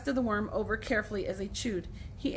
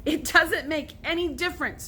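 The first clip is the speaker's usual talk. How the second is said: yelled; 5 semitones higher than usual. A narrator's reading. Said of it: "Joe yelled at Billy".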